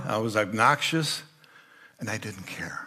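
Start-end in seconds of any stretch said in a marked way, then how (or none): 2.0-2.8 soft unvoiced whisper; whispered aside. Real, not acted. Speaker confessing to the congregation in a whisper. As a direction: drop to a whisper.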